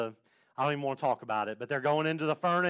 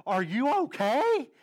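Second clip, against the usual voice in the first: higher, drawn-out questioning; concerned check-in; 8 semitones higher, 3 dB louder, slower than usual. Retold or imitated. Speaker to friend hunched in the theater, concerned and drawn out. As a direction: lean over and ask, slowly and pitched up.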